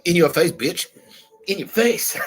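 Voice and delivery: high, loud playful taunt; mock gloating. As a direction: rub it in with rising glee.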